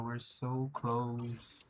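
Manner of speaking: We're so close, breathed slow and soft; hushed and drawn out. Real, not acted. Streamer savoring the near win, hushed and slow. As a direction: let it hang, almost to yourself. Repeating near-goal to himself.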